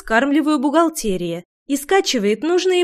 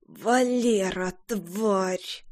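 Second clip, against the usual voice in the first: strained wheezy croak, softer; hoarse rasp; 3 semitones lower, 8 dB quieter, much slower than usual. Acted. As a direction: rasp it out, hungover. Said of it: "прохрипела я".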